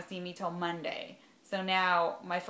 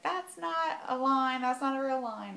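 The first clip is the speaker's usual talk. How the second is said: lightly voiced husband brushing it off; mimicking the husband's deflating verdict; imitating husband; 6 semitones higher than usual. Husband dismissing faint test line.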